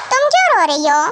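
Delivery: ordinary delivery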